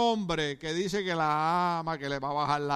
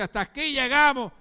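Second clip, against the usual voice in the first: firm loud limit; 8 semitones higher, 8 dB louder than usual. Retold or imitated. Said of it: "El día que usted dice".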